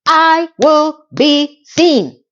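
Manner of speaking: a bride banging the table, demanding to be noticed; defiant declaration. Retold or imitated. Charlotte insisting she will be seen, slow loud declaration.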